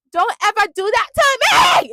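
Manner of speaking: high, loud retold outburst; indignant mock yell. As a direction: imitate an indignant shouted warning. Retelling a line that annoyed her.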